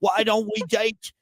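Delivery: high, hammed-up British dating-show voice; mock reality-show guy. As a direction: ham up a Love Island suitor wooing her. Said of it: "That's how they say it".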